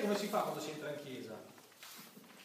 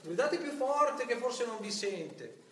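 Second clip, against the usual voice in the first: Elijah taunting; 8 semitones higher, 6 dB louder than usual. Retold or imitated. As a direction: taunt the prophets of Baal to shout louder. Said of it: "e Elia gli dice".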